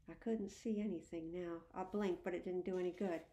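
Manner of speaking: plain reading voice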